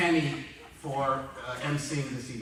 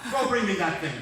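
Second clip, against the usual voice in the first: stern order; 5 semitones higher, 5 dB louder than usual. Retold or imitated. Father orders son at dinner.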